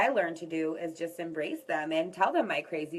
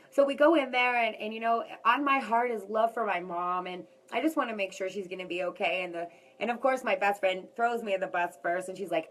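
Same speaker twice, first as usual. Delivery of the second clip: usual speaking voice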